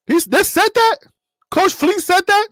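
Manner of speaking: high, loud disbelieving question; can't believe the coach said that; shocked disbelief. Real, not acted. Shocked reaction to news.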